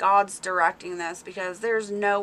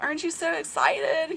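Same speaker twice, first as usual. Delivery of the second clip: higher, eager mock question; re-enacted excitement; 7 semitones higher than usual. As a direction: imitate your excited self, pitched up. Re-enacting her own excitement.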